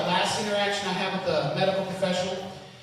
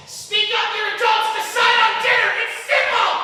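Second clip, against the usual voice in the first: high, loud comic hollering; exasperated yelling; 14 semitones higher, 4 dB louder than usual. Acted. Comic scolding couples over choosing dinner, exasperated yelling. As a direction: holler each demand in mock exasperation.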